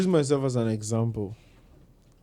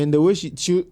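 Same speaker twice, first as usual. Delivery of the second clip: ordinary delivery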